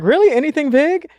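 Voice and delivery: high, eager questioning; high-pitched probing. Retold or imitated. Quizzing a seatmate who says she's an actress, high and probing. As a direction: pitch it high, fishing for details.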